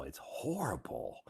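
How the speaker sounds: a hushed, horrified aside; whispered disgust. Retold or imitated. Visitor whispering about the farm smell, appalled. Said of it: "somebody says".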